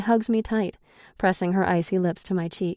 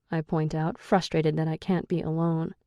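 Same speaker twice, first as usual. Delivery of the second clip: ordinary delivery, nothing marked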